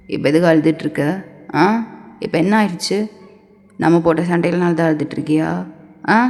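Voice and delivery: low-voiced man, gently probing.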